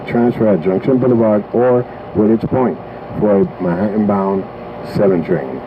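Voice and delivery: spliced together words. Acted. Deep distorted announcement voice.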